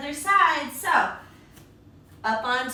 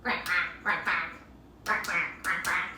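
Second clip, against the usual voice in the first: playful duck imitation; duck quacks; 7 semitones lower, 3 dB quieter than usual. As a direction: lower quacking duck noises. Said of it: "and they go quack".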